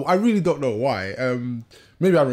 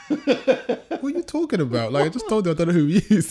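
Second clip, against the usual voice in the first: exasperated laughing; 6 semitones higher than usual. Retold or imitated. Comedian retelling his baffled reply to the geezer, laughing. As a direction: protest in amused disbelief.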